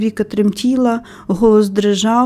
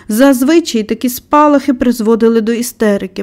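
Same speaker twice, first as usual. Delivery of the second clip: ordinary delivery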